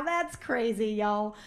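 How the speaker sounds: ordinary delivery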